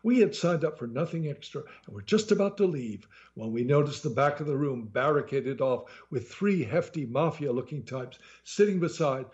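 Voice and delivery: ordinary delivery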